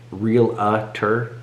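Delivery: realtor split slowly into real-uh-tor; mispronounced realtor. Shown as a demonstration. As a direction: sound out the extra "uh" in realtor. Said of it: "I literally have to spell check".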